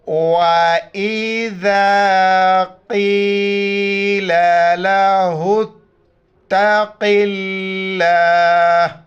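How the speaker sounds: slow, chanted tajweed recitation; Quran recitation. Shown as a demonstration. Teacher recites the verse for students to repeat after. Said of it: "میرے ساتھ ان الفاظ کو دہرائیے".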